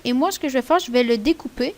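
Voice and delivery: ordinary talk, nothing marked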